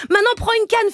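ordinary talk, nothing marked

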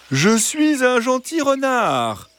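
high, slow sugary fox voice; high gentle fox. A narrator's reading. Fox persuading the hens he is kind, high and slow. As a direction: sell it as a harmless fox.

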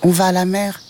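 high little kitten voice; high kitten question. Acted. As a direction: ask as the small tabby kitten. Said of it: "répondit le chat".

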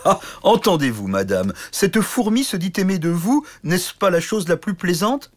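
scornful big knight, amused; mocking giant. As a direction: sneer at the tiny suitor. Giant mocks the little knight.

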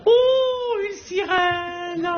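très haut et très lent, ébahi; s'extasier devant une sirène; émerveillé. Pretend. Voit une sirène dans l'eau. (émerveillement aigu et lent devant la sirène)

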